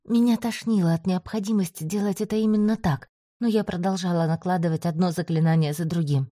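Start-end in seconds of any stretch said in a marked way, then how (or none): none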